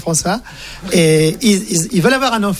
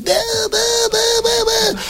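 put-on high wailing baby bawl; baby crying sounds; 13 semitones higher than usual. Crying babies in the maternity ward, high bawling. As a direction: wail like a newborn in the nursery.

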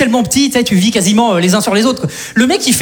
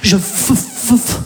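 stuck on f-; mock stutter at the counter; fake stutter; much slower than usual. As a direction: stammer badly on the f. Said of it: "faire semblant de bégayer".

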